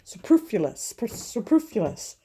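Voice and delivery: trying out "superfluous", with a stumble and restart; careful, halting attempt at "superfluous"; effortful pronunciation. Real, not acted. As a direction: sound out "superfluous" slowly, retrying after a slip. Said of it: "look up how you pronounce it".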